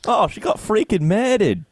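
put-on high, playful Aussie accent; mock Aussie outrage, lightly done; hint of an Australian accent. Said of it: "Australian Bones".

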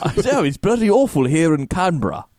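high, hammed-up Aussie; exaggerated Australian accent; mock Australian. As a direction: play an Australian from Canberra. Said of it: "Australian Bones".

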